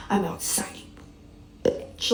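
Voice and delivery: a sinister voiceless whisper; creepy demon whisper. Retold or imitated. A demon posing as Martin whispers menacingly down the phone. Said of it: "The way this thing was speaking".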